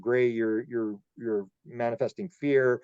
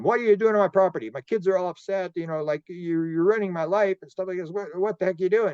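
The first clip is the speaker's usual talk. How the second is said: upset complaint; 7 semitones higher than usual. Retold or imitated. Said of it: "And Chris asked him".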